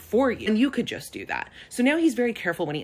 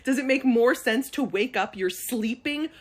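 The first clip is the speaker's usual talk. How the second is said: exasperated rant; 8 semitones higher, 5 dB louder than usual. Retold or imitated. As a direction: rant at him in disbelief. Ranting at her dad.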